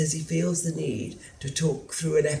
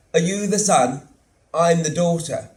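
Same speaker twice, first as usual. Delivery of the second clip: blunt demand; 6 semitones higher, 8 dB louder than usual. Acted. Daughter confronting him at door.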